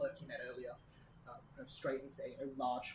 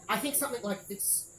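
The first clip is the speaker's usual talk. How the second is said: flamboyant designer; 7 semitones higher, 6 dB louder than usual. Acted.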